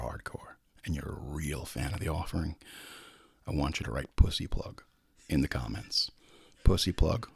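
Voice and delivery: low, soft, hushed voice after the credits; hushed secret. Acted. Host sneaks a secret request to loyal listeners, hushed. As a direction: lean in and let them in on a secret.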